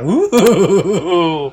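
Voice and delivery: high, loud wavering ooh; teasing "ooh". Pretend. Host reacting to Latin passion remark, teasing high whoop. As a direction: whoop a drawn-out, wobbling ooh.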